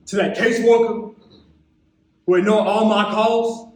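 building to a high, loud outburst; impassioned poetic protest, rising in pitch and force; angry indictment. Acted. Poet rounds on caseworker and counselor.

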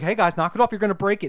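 warning dad. Retold or imitated. Retelling the dad warning the boys. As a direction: warn them off, voice up. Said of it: "he's telling him".

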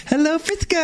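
very high lisped greeting; "Hello, Frisco" impression. Repeating the Dodger fan's catchphrase, high mock greeting. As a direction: chirp it high in a put-on voice. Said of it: "What do you say?".

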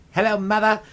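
lightly posh English mother voice; British accent. Retold or imitated. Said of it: "good British accent except for when".

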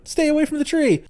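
high mock urgent warning voice; mock mother's warning. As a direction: pitch it up like a frantic mom on the phone. Mother relays warning to daughter.

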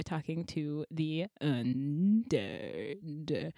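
slow zombie drawl. Acted. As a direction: drag it out like a zombie. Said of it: "That was supposed to be a zombie voice".

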